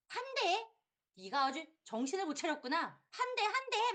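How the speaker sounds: high, loud mock-taunting mother; mocking strict mother. 아이를 몰아붙이는 엄마. (아이를 다그치는 엄마처럼 높게)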